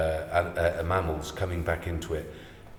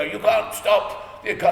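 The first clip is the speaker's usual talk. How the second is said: much higher, louder mock voice of a know-it-all; pompous naysayer; 12 semitones higher, 4 dB louder than usual. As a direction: lampoon a smug doubter, squeaky and loud. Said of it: "imagine it was someone like this saying".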